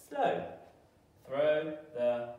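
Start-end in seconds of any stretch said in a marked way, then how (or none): 0.1-0.5 short high "slow", falling quickly; bright slow. Shown as a demonstration. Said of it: "let's read it together".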